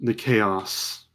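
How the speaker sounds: slow, hushed scene setting; measured storyteller voice; game master's vision narration. Acted. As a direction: draw them into the vision.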